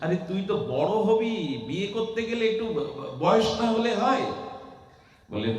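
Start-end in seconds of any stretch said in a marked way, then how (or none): 0.0-4.2 much higher, louder, amused mother scolding; teasing mother. Acted. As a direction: tease the boy, stumbling on ব-. Mother teasing her son. (উঁচু স্বরে মায়ের ঠাট্টা)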